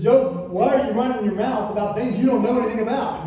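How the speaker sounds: loud, high scolding God; stern rebuke. Acted. God scolding Job for running his mouth, loud and stern. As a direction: call him out hard.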